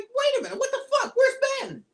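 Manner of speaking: a high, baffled what-the-fuck; shocked disbelief. Retold or imitated. Fan confused that Aunt May died, high shock. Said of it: "I'm like".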